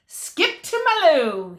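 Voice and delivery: loud, high square-dance call; shout. A narrator's reading. Cowhand heading to the dance, shouting out a call. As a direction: belt it like a dance caller. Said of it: "he shouts".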